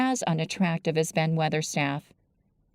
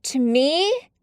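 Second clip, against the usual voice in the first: higher, surprised little question; taken aback, pointing to herself; surprised girl; 8 semitones higher, 4 dB louder than usual. Acted. Mary surprised.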